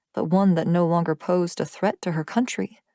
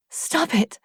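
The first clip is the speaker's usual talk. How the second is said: higher anguished stop it; pained cry; 8 semitones higher than usual. Acted. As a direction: cry out to make him stop. She begs him to stop.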